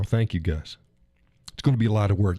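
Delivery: usual speaking voice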